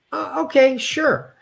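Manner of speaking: caving in to friends choosing Fatburger; reluctant giving in. Retold or imitated. High reluctant consent. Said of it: "And I was saying".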